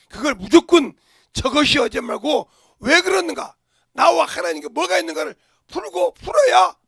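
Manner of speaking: loud, high, forceful preaching; preaching shout. Real, not acted. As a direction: drive it home at full force. Preacher climaxes on repentance.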